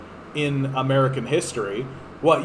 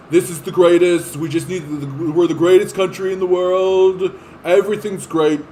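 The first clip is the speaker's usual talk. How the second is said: louder Trump bluster; Trump impression; 3 semitones higher, 5 dB louder than usual. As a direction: brag like Trump. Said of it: "Donald Trump was great for impressionists".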